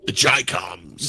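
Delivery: slow and deep, a mock ominous echo; mock grave repeat. Co-host echoes the phrase back in a slow, deep, mock-ominous voice. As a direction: drag it out low like a sinister announcement.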